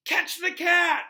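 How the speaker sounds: high, loud slow yell. A narrator's reading. Ron yelling to the room as Scabbers flees, loud. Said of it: "Ron yelled".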